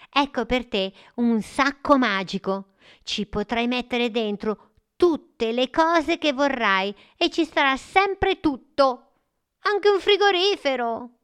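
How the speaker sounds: lightly voiced eager boy; a hint of an excited child. The boy gives gifts. (voce da bambino)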